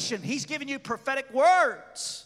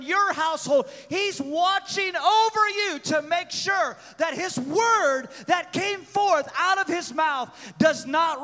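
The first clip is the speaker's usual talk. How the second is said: loud, higher sermon build; preaching at full force; 4 semitones higher, 5 dB louder than usual. Acted. Preaching climax.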